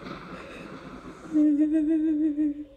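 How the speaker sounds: high breathy giggle; a light giggle. Real, not acted.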